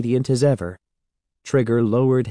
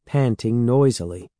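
usual speaking voice

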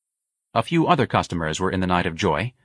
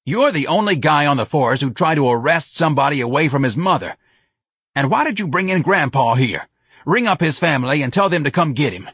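a raised, hectoring sergeant berating his officer; angry police sergeant; 8 semitones higher, 5 dB louder than usual. A narrator's reading. Sergeant berating patrolman Mancuso, loud and scornful. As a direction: chew him out like a fed-up desk sergeant.

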